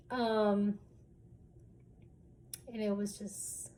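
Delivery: ordinary talk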